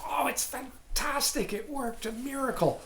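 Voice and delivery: high, gushing imitation of delight; hammed-up amazement, pitched well up; mock elation. Students report back that the practice worked, mock-gushing.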